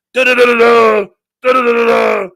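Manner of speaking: high, loud nonsense syllables; mock blaring "da-da-da". Speaker mocking the man's lecture, loud high babble.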